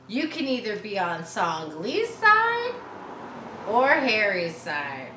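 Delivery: a lightly voiced bossy announcer; Mary announcing. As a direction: announce the big game to the class. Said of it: "Mary announced".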